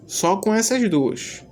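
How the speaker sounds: ordinary talk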